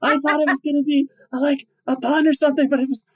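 very high, squealing hammed-up hysterics; mock hyperventilating hilarity. Imitating a crowd floored by an old joke, mock hysterics. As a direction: act helpless with laughter, far too impressed. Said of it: "people were, like, hyperventilating".

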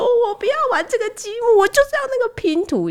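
put-on high, whiny kid demanding; whining child. Toddler wails for his puzzle, high child voice. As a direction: whine it like a wailing toddler.

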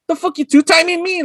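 high-pitched accusation without extra volume; mock mad. Boyfriend confronting his two-timing girl, mock mad. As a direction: confront a cheating girlfriend, half in earnest. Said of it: "got mad at her".

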